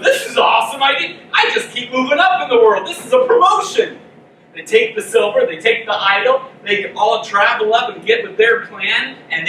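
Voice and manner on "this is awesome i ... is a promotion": higher, louder, delighted with a laugh; hammed-up giddy joy at a promotion; thrilled priest. Priest gloating over his promotion, giddy and laughing. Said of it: "The priest goes".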